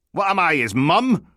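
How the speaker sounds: high, loud sarcastic question; indignant retort. Acted. Detective asked where the boss is, loud indignant retort. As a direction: throw the question back, affronted.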